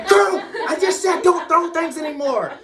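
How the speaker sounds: high, very loud scolding yell; angry shout. Retold or imitated. Dad hit by toy car scolds son, angry shout. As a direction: yell at the kid at full volume.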